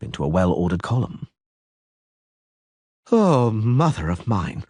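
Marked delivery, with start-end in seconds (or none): 3.1-4.6 slow, timid little whimper. A narrator's reading. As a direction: let it slip out frightened and slow. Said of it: "Dandelion whimpered timidly".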